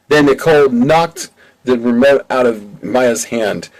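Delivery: ordinary delivery, nothing marked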